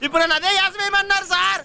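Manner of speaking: high-pitched complaint; aggrieved wail. Acted. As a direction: protest like a wronged victim. Complaining about forced costume role.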